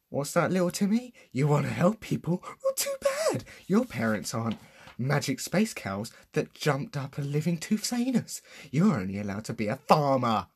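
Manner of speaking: high, loud, condescending put-on voice; mocking character voice. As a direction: sneer it at little Timmy. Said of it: "that really hurt my throat".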